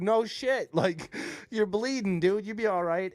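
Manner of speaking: laughing retort. Retold or imitated. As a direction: laugh him off, amused and loud. Laughing at bleeding worker's call.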